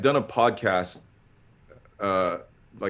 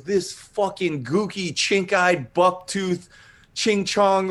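high, slow wrestler's taunt; Roddy Piper; 9 semitones higher, slower than usual. Retold or imitated. Hired wrestler insulting the man who booked him, in-character tirade. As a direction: imitate a wrestler ripping into someone. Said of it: "he just went into the character".

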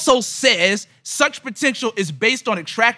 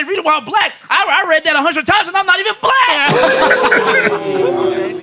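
imitating Paul; 10 semitones higher, slower than usual. Paul voiced, laughing, higher.

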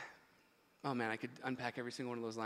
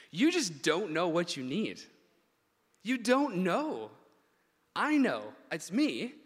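slow, loud and raised, stretching "don't know"; emphatic telling-off; 4 semitones higher, 7 dB louder, slower than usual. Retold or imitated. Preacher voicing what Jesus is actually saying, slow emphatic correction. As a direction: drive the point home word by word. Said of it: "here's what I feel like he's actually saying".

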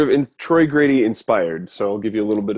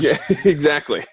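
laughing; 5 semitones higher than usual. Real, not acted. Reacting to the rubber band story.

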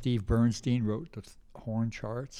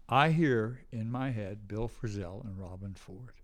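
ordinary talk, nothing marked

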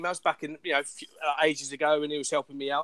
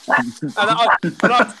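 ordinary talk

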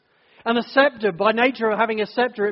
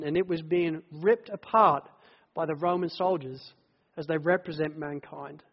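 ordinary delivery

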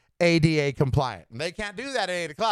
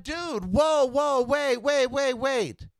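high-pitched, insistent plea to hold on; retold alarmed protest; 6 semitones higher, much slower than usual. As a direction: cut in to halt an angry stranger, voice raised in pitch. Retells trying to halt yelling man.

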